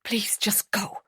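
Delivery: whispered, barely voiced; under her breath. A narrator's reading. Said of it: "she said under her breath".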